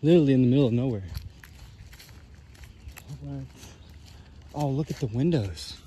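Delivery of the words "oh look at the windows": ordinary delivery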